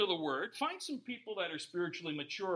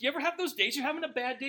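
ordinary delivery

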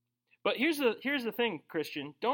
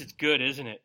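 ordinary talk

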